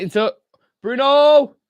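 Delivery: loud, high cry of the player's name; excited shout. Real, not acted. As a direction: shout it out as the attack builds. Reacting live to the attack.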